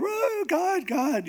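very high wailed "Oh, God"; acted high-pitched awe; imitated crowd cry.

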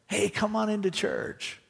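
lightly voiced pastor waving him in; coaxing pastor. Pastor voiced inviting smoker.

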